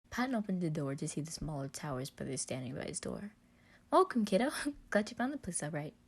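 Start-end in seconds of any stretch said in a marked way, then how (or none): 3.9-5.9 higher, louder happy greeting with a laugh; beaming father-figure welcome; cheerful welcome. Acted. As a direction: welcome him in brightly. Patton cheerfully greets Roman.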